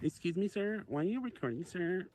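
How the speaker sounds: high mock lady voice asking politely; mimicking a lady. Streamer mimicking the woman confronting the auditor in a high voice. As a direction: put on a prim, high woman's voice.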